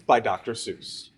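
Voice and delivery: ordinary delivery, nothing marked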